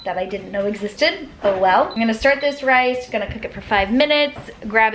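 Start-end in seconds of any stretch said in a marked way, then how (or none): none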